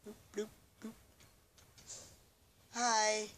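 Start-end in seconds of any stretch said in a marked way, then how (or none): 0.1-0.9 high, soft bloops; tiny popping sound effect, sung out softly; little high, quiet bloops; imitated bloop noises.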